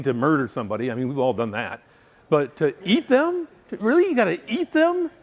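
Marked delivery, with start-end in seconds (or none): 2.3-5.1 high-pitched comic incredulity; hammed-up outrage, climbing higher; mock disbelief. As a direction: squeal the question as if appalled. Mock outrage at cannibal killer.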